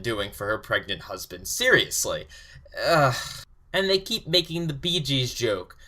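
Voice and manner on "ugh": short fed-up "ugh"; exasperated groan. Real, not acted.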